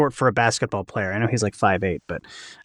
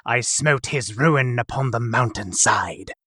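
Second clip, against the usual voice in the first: epic fantasy hero; 3 semitones lower, slower than usual. Pretend. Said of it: "I want to hear you say".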